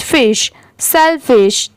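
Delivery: फिश first, then सेलफिश slowly as one word; part then whole. Shown as a demonstration. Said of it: "S E L सेल हो गया और F I S H". (पहले फिश फिर पूरा सेलफिश धीरे से)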